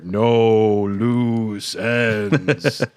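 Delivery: drawn out, very slow monster words. A narrator's reading. Narrating the monster's words, very slow creature voice. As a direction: stretch each word like a creature. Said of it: "the monster's words finally become clear".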